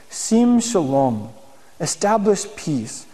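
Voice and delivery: slow, reverent Hebrew recitation; prayer reading. Acted. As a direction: savour each Hebrew word. Reads Hebrew prayer and translates.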